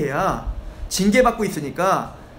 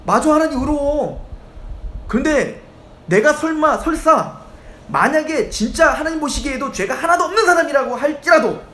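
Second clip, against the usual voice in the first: higher and louder, bitter defiance; defiant Job; 6 semitones higher, 3 dB louder than usual. Retold or imitated. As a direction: Job pushing back hard against God. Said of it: "이 뉘앙스가 어떤 거냐면".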